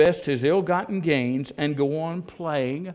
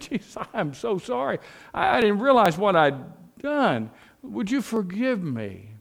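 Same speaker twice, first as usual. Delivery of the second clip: higher, pleading sinner at the cross; pleading apology; 8 semitones higher, 4 dB louder than usual. Retold or imitated. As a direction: beg forgiveness, slowing at the end. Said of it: "knelt before Jesus and said".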